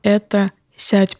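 ordinary delivery

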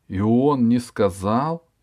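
slow, over-articulated words; slow careful articulation. Acted. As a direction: spell it out carefully and slowly. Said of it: "старательно выговаривая слова".

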